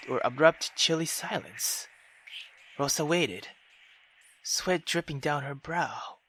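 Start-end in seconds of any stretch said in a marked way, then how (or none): none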